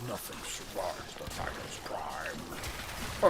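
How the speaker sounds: low, slow, soft movie-trailer voice; deep epic narrator. Pretend. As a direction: rumble it like a film trailer, slowly.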